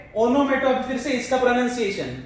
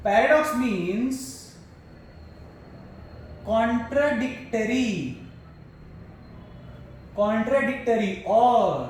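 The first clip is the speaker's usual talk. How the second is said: slow dictation; 3 dB quieter, much slower than usual. Shown as a demonstration. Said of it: "teacher dictating definition".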